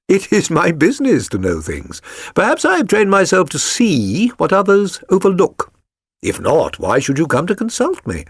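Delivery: lightly voiced Holmes, amused and assured; a hint of the calm detective. A narrator's reading. Holmes reassuring his client, lightly voiced.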